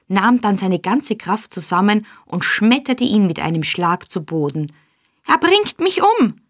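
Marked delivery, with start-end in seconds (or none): 5.2-6.4 high panicked cry of a beaten bully; terrified scream. A narrator's reading. Noah pinned down, screaming for help, high and panicked. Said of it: "schrie Noah".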